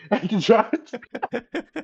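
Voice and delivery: words squeezed out through laughter; slow, breaking into laughter; laughing. Real, not acted. Laughing through his story.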